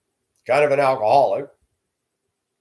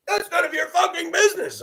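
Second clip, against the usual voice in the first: much higher, snarling put-on voice; angry neighbor. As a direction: snap it as the hostile neighbor.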